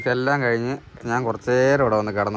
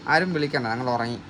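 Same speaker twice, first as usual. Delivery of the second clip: ordinary delivery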